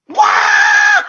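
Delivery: very high loud shriek of alarm; husband startled by smoke; shocked cry. Acted. Sees smoke from house.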